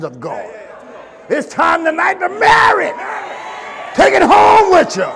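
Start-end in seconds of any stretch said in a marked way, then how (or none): 1.3-5.1 loud, high preacher's cry; preaching at full force. Acted. Preacher urging the church, at full force. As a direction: drive the exhortation home like a pulpit call.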